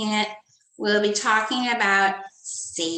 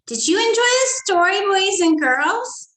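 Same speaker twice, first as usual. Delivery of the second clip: excited teacher question; 8 semitones higher, 7 dB louder than usual. Acted. Teacher asks class about story.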